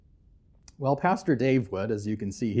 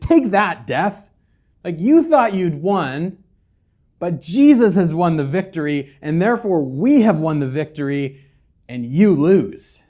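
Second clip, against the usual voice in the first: loud, higher taunting jeer; 4 semitones higher, 8 dB louder than usual. Acted. Paul taunting death like trash talk at cards, loud. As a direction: rub the defeat in death's face. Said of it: "he's taunting death".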